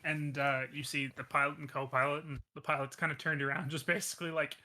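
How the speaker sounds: ordinary talk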